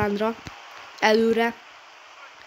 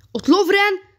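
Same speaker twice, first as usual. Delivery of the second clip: excited call; 9 semitones higher, 5 dB louder, slower than usual. Real, not acted. Gamer calling the play.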